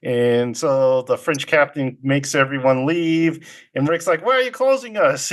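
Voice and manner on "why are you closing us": high-pitched mock protest; lightly acted, high indignant query; protesting question. Rick protests the bar closing.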